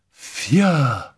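high, drawn-out word of dread; fearful. Acted. Simone flung her hands out, fearful.

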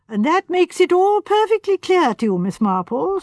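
high, playful amusement; teasing twinkle. A narrator's reading. Sir Henry teasing Miss Marple, playful. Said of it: "said Sir Henry with a twinkle".

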